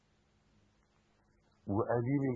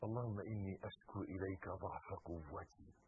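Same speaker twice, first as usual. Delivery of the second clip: hushed prayer; 4 semitones lower, 9 dB quieter than usual. Acted. Quoting the Prophet's prayer.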